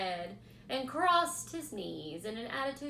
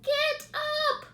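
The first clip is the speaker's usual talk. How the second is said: much higher, louder scream; 10 dB louder than usual. A narrator's reading. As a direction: pig yelling at lazy rat. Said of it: "screamed Wilbur".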